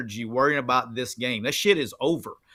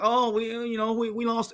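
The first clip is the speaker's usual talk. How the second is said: higher, softer mock whimper; pitched-up sulky imitation; mock whine; 7 semitones higher, 3 dB quieter than usual. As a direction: mimic a moping loser. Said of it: "You can't be like".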